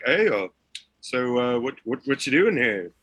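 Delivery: put-on a clumsy Canadian border guard accent, pitched a bit up; mock Canadian accent, half-hearted; bad Canadian accent. Guard at the border quizzing the friend in a bad Canadian accent. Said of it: "that's shit accent".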